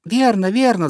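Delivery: high-pitched eager cry; little robin crying out. A narrator's reading. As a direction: cry out agreement like a small bird. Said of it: "закричала маленькая Малиновка".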